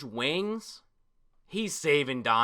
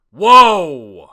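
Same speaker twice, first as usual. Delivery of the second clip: loud, startled whoa; real shock; 7 semitones higher, 24 dB louder than usual. Real, not acted. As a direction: jolt back in real surprise. Sudden reaction to a new villain.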